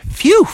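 high-pitched whew of relief; exaggerated sigh of relief; relieved phew. Relief on escaping the cave.

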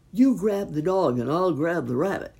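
loud, forceful command; called-out order. A narrator's reading. Biggest fox ambushing dog and rabbit, called-out order. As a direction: call it out hard to an accomplice. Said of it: "called the biggest fox".

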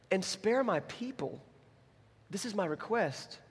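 soft, slow, lifted-pitch petition; hushed, measured appeal; solemn plea. Acted. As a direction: plead slowly and quietly for your people. Acts Esther pleading for her people.

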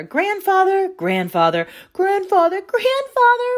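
loud, high chorus of calls; calling together. A narrator's reading. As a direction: call out as loud as you can. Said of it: "they all called together".

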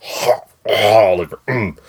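slow, stuttering hologram voice; glitchy hologram. Pretend. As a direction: glitch like a broken transmission. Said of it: "Got a little hologram voice going on".

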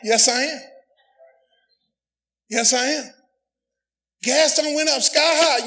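usual speaking voice

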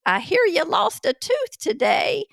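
high, creaky granny voice; sweet old granny at the door; old lady voice. Retold or imitated. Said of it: "trying to give my best old lady voice".

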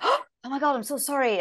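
gasp, then apologise profusely as the co-star; apologetic gasp. Retold or imitated. Co-star realizing his hand is hidden, mock startled apology.

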